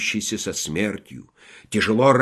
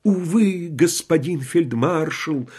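sighing, high pitched; 6 semitones higher than usual. Acted. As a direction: sigh it out from the deathbed. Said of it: "вздохнул умирающий воин".

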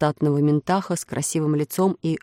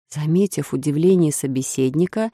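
ordinary delivery

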